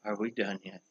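soft, drained ask; barely has the energy to ask; worn-out plea. Retold or imitated. Exhausted, asking Bob again.

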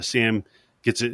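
ordinary talk

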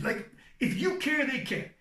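usual speaking voice

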